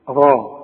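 غا with a full, dark, backed "aa"; correct heavy Ghayn. Shown as a demonstration. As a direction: thicken the vowel of غا. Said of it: "غين في القرآن ما دام مفتوحة".

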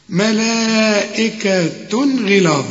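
ملائكة غلاظ recited slowly with the long vowel held; slow recitation. Shown as a demonstration. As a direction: stretch the madd in ملائكة غلاظ. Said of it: "أهو ده المد الواجب". (تلاوة بطيئة مع مد ملائكة)

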